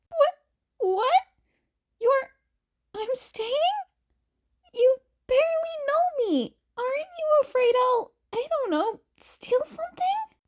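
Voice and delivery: high, disbelieving, fumbling; flustered Izuku. Acted. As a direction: stammer out your shock at being trusted. Izuku shocked at being kept.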